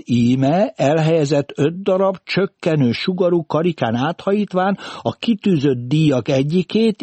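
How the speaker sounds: loud, high schoolroom recitation; reciting a lesson. A narrator's reading. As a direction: rattle it off like a memorised lesson, rising as it goes. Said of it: "mintha leckét mondana fel".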